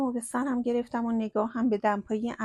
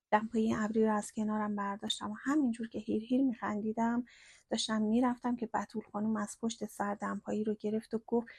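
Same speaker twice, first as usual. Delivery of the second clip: usual speaking voice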